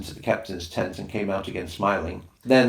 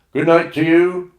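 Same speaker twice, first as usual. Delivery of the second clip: called good night; 9 semitones higher, 11 dB louder than usual. Acted. Boatman calls back from the water.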